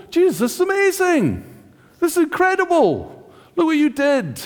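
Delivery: high, excited gushing at Jesus; overflowing with awe; amazed praise. Retold or imitated. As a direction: gush with wonder like a delighted worshipper.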